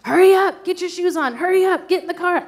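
mock frantic mom hurrying kids out the door; rushing yell. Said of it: "I'm constantly like".

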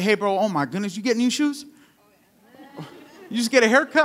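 butter up a buddy with put-on amazement; gassing someone up. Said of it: "they start gassing you up".

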